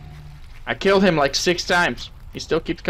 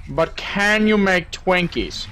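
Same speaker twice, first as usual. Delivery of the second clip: ordinary delivery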